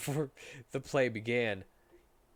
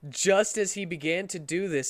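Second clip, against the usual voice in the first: usual speaking voice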